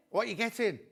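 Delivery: ordinary delivery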